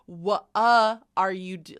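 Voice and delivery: "What" broken loudly into wa-uh; wrong W with schwa. Shown as a demonstration. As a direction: punch an extra "uh" into "What". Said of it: "So we don't say".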